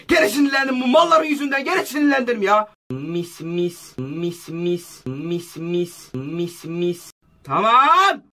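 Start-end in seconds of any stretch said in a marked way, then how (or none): 2.9-7.1 much softer, lingering repeated mis; soft savoring. Real, not acted. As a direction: murmur it softly like savoring a treat. Savoring something, softly repeated. (keyifle, alçak sesle mırıldanarak)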